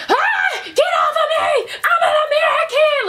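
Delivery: very high mock scream; hammed-up high panicked yell; retold screaming. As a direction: shriek it like someone just grabbed you. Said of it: "So I start screaming".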